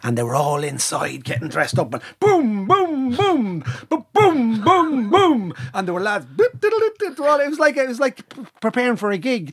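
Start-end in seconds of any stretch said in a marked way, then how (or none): 1.9-5.5 booming drum beats, pitched up; high mock drum booms; drum imitation. Imitating the Lambeg drum beat. 6.2-7.4 high tootling whistle sounds; squeaky mock fife notes; whistle imitation.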